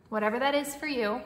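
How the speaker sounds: ordinary talk, nothing marked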